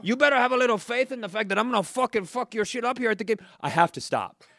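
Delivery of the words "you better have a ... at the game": high, fast threatening bro. Acted. Drunk fan threatening a rival, high bro threat. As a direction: threaten like a drunk tough guy. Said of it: "I did that voice".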